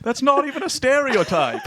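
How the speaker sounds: high, put-on Scottish accent; mock Scottish protest. Offended Scottish panelist objecting, mock Scottish accent.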